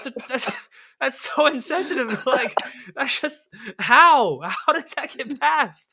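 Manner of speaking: real, helpless, appalled laughter through the words; incredulous laughter. Real, not acted. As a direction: crack up while protesting, words breaking into laughs. Cracking up over tasteless graphic.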